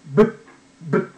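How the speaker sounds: ブッ as a short, stopped B burst; B sound. Shown as a demonstration. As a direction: pop ブッ out, cut off at once. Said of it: "Bはブッっていうらしい".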